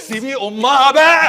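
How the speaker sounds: high, drawn-out furious shout; explode, stretching the words out loud; exasperated yell. Acted. Man yelling at woman to let go of bag, exasperated. Tug over bag, loses patience.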